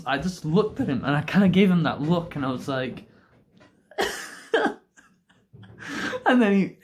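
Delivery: ordinary delivery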